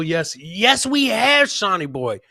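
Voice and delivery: ordinary delivery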